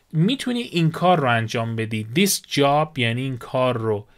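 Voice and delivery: usual speaking voice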